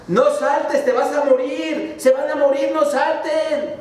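high, shouted warning, dragging at the end; frogs shouting harder. Acted. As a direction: yell it down the well. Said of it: "gritaban más duro todas las ranitas".

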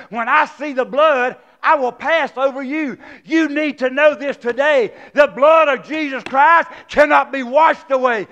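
loud, driving sermon voice; preaching at full force. Acted. Pastor preaching full force to the congregation.

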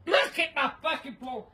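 usual speaking voice

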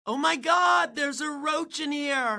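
high-pitched squeal of disgust; scared scream. Acted. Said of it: "don't start screaming like a sissy".

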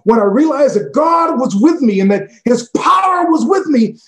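very high, loud shout. Retold or imitated. Pastor in restaurant shouting his testimony. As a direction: call it out across the room. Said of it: "he shouted in the restaurant".

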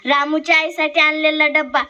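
ordinary delivery

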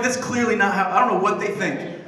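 normal talking voice

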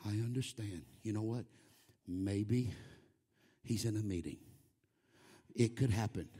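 mock-gentle, very low and soft; very understanding. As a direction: soothe with exaggerated patience, voice dropped right down. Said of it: "we need to be very understanding".

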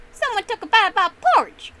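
high, very loud outraged roar. A narrator's reading. Daddy Bear finding his porridge eaten, roaring. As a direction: roar it at the top of your voice. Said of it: "Daddy Bear roared".

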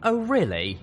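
high, loud challenging interest; perked up. A narrator's reading. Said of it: "The jade-armored Pegasus perked up".

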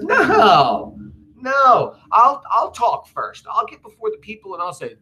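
high, drawn-out cartoonish no; hammed-up overconfident refusal; dismissive reassurance. Brutus dismisses Cassius's worry, paraphrased.

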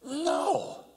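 high, sharp dismissive "No!"; same scornful refusal repeated. Retold or imitated. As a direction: bark it with contempt. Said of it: "He goes".

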